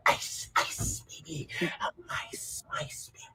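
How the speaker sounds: put-on whispered ice ice baby. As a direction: breathe it as a secret chant. Whispered mock rap.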